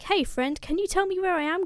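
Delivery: high, ingratiating mock greeting; friendly hailing. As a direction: hail a stranger with playful politeness. Odysseus hails a stranger, asking directions.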